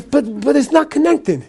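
high, stammered mock protest; objecting Russian listener. Russian man answering the sun-comes-up claim, protesting. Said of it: "this Russian guy goes".

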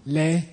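medium-length 'Le'; middle length. Shown as a demonstration. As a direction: hold the vowel of 'Le' a little. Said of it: "three different lengths of it".

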